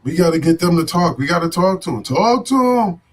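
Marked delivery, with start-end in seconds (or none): 2.0-3.0 higher and louder, punched out; shouted catchphrase. Real, not acted. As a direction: call it out like a slogan.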